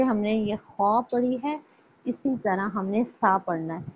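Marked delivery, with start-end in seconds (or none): none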